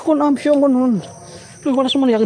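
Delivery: high, loud alarmed exclamations; high startled yelps of alarm; startled excitement. Real, not acted. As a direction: cry out as the flame jumps. Reacting to candle flaring.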